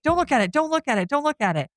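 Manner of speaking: a retold rush of begging; panicked pleading. Mina begging Josh not to look, panicked and fast.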